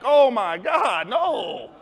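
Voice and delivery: slow, loud dismay; hammed-up panic; horrified teller. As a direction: stretch it out in alarm.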